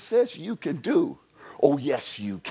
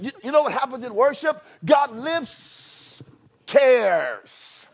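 higher and louder, driving on; fiery preaching; 8 semitones higher, 3 dB louder than usual. Acted. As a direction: preach it out to the congregation. Preaching at full force.

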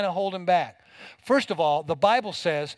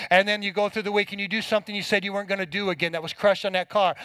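ordinary talk

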